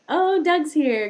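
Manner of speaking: high, loud and drawn-out delight; light up as a friend walks in; delighted greeting. Real, not acted. Host delighted to see Doug join the stream, bright loud greeting.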